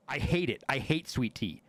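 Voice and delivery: ordinary talk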